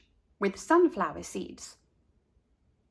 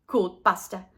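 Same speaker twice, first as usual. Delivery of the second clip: plain reading voice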